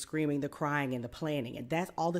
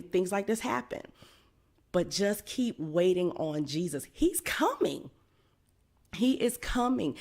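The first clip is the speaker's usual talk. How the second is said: louder, building preacher's fervor; rising sermon; 3 semitones higher, 3 dB louder than usual. Acted. Preacher urging the congregation to keep waiting, rising fervor. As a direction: preach it, swelling toward the call.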